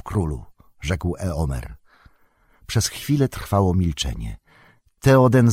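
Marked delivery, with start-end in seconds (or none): 0.0-0.4 a softer, lower "królu" from a kneeling knight; quiet address. A narrator's reading. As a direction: let "królu" sink down softly. Kneeling knight ends his plea.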